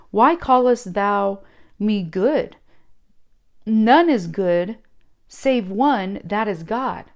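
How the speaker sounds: slow, loud proclaiming of scripture; solemn King James reading. Acted. Reading Jesus' words from scripture.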